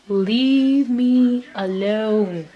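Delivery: drawn out, begging leave me alone; slow pleading repeat. Real, not acted. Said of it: "but leave me alone".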